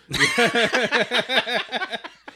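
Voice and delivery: high-pitched burst of laughter. Real, not acted. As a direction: cackle at your own joke. Laughing at own bit.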